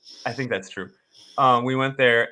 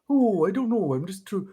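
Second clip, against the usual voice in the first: higher cartoony Midwest voice; Bullwinkle-like voice; 6 semitones higher, 4 dB quieter than usual. Imitating the actor's Ed Gein to a friend, higher cartoony voice. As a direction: play a dopey cartoon moose. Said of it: "it's Bullwinkle".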